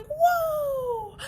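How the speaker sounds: high loud frightened cry; scared whoa. Acted. Grass seeing the mower coming, high scared cry. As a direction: yelp in fright. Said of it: "like they're going".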